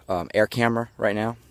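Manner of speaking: ordinary delivery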